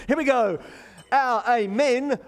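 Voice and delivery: a bigger, rousing Our amen. Shown as a demonstration. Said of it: "a bit more exuberantly than that".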